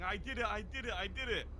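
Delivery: high-pitched mock scream at no extra volume; retold screaming. Witness imitates Goofy running out of the shop, mock screaming. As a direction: imitate a triumphant yell, pitched well up. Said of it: "Goofy come out screaming".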